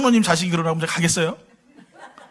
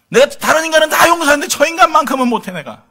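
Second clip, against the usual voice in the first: mock stubborn grudge; 6 semitones higher, 6 dB louder than usual. Imitating a grudging believer.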